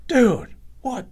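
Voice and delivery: high, stunned repeated exclamation; acted disbelief. Rival staring at the stapled leg, high imitated disbelief. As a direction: imitate a dumbfounded buddy.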